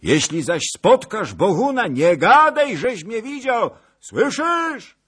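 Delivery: high, loud distant shouting; calling after him. A narrator's reading. Zagloba calls after the departing rider, high shout. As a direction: holler instructions after a galloping horseman.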